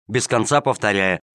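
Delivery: usual speaking voice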